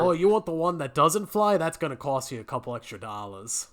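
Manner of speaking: ordinary talk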